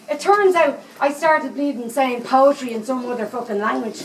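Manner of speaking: ordinary talk, nothing marked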